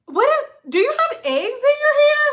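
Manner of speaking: high-pitched, incredulous questioning; appalled question. Retold or imitated. Stylist to client after smelling her hair, appalled. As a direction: ask it in shrieky disbelief, pitch way up.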